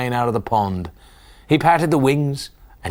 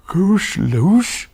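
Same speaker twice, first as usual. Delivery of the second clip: sleepy yawn; 8 semitones higher, 4 dB louder than usual. Acted. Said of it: "yawned sleepy Farmer Jolly".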